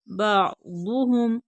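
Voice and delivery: बादुहुम slow, throat catch after बा and heavy दु; throat ain, full daad. Shown as a demonstration. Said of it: "दौत पुर पढ़ेंगे". (बादुहुम, हलक से आइन, पुर दौत)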